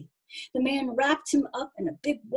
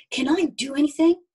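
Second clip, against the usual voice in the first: put-on higher and louder, an eager young voice offering help; eager little helper; 5 semitones higher, 6 dB louder than usual. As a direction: pipe up keen to be useful. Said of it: "Eager to help".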